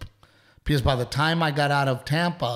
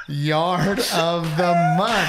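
overjoyed; 3 semitones higher, 5 dB louder, much slower than usual. Real, not acted. Proud of winning yard award.

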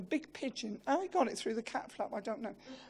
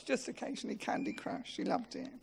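hushed aside; 7 dB quieter than usual. Real, not acted. Fond aside about her habit.

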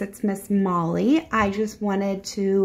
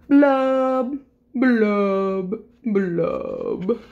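glum fish blubbing; much slower than usual. Acted. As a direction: draw out each blub, sinking down. Gloomy fish's drawn-out blubs.

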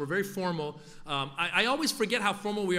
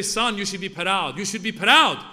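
put-on louder, lecturing in a Persian accent; proud dad, Persian accent; 3 semitones higher, 8 dB louder than usual. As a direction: preach pride to your son in a Persian accent. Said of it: "And my dad would say".

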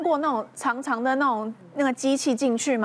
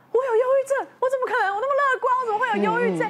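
incredulous retort; 12 semitones higher, 3 dB louder, faster than usual. Retold or imitated. Incredulous reply to friend's diagnosis.

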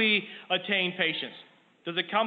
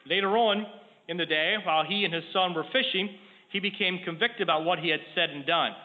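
ordinary talk, nothing marked

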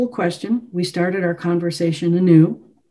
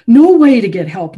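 loud, higher, peak of the accusation; angry outburst; 6 semitones higher, 9 dB louder than usual. A narrator's reading. Chopin accusing the visitor's father, loudest angry outburst. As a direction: hammer NO at full volume. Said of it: "His eyes were afire".

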